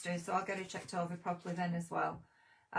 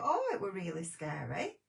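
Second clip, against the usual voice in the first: normal talking voice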